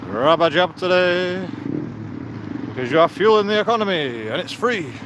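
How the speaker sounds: mock advert. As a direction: sell it like a cheesy ad, sarcastic. Mock vaccine advert slogan.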